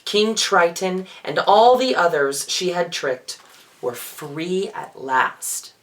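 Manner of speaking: plain reading voice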